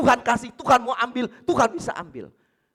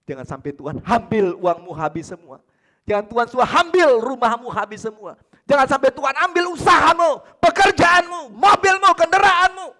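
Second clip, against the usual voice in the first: building louder and higher to a shout; fiery preaching; 8 semitones higher, 13 dB louder than usual. Acted. Preacher building to a shouting climax. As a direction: preach it harder with every item. Preaching at full force, rising.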